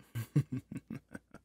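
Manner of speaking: a short gloating laugh that lifts at the end; smug chuckle. Acted. Villain gloats to his bride about her friend with a smug chuckle. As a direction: laugh at the thought of the rival, pleased with yourself. Gloating over rival's wedding.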